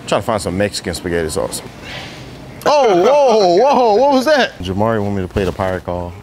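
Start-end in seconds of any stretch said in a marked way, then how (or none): 2.7-4.5 sudden startled whoa, pitched up; surprised. Real, not acted. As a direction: caught off guard by a noise. Startled by a loud noise.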